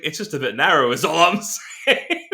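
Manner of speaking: retold line squeezed out through a laugh; laughing punchline. Real, not acted. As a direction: crack up before finishing the quote.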